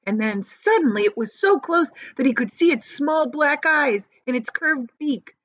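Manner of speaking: plain reading voice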